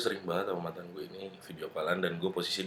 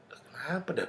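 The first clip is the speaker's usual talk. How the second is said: hushed, puzzled whisper to yourself; 4 semitones higher, 10 dB quieter than usual. Retold or imitated. Narrator whispers, puzzled why his girlfriend cries. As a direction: whisper the question under your breath.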